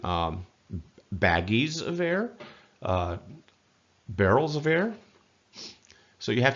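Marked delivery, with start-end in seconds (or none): 1.1-2.3 "baggies of air" slow, climbing high at the end; puzzled question. Shown as a demonstration. Mock query about packaging air. 4.1-4.9 "barrels of air" climbing high to the end; puzzled question. Shown as a demonstration. Mock query about packaging air.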